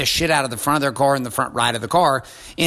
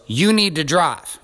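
slow, higher-pitched insistence; firm order; 4 semitones higher, slower than usual. Retold or imitated. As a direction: imitate a wife laying down the rule. Wife's acted order to the girl.

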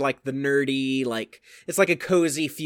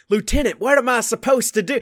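lightly put-on southern accent, pitched up; mock southern accent asking the lieutenant for help; imitating her southern accent; 7 semitones higher, 3 dB louder than usual. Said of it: "she, like, kept the accent".

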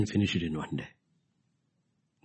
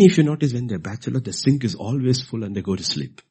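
ordinary talk, nothing marked

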